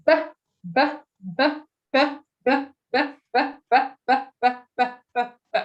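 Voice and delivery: sped-up b sounds. Shown as a demonstration. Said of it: "a little bit quicker".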